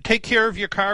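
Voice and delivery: ordinary talk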